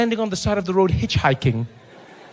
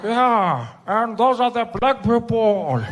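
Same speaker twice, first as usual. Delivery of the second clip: put-on old Mandela; 4 semitones higher, much slower than usual. Mandela's dry reply.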